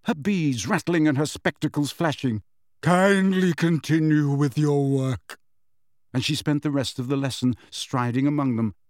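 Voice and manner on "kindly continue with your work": slow, drawn-out, dramatic command; theatrical icy dignity; affronted teacher. A narrator's reading. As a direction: dismiss them with wounded grandeur. Outraged teacher orders class back to work.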